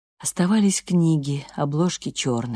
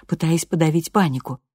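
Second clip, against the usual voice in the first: ordinary delivery, nothing marked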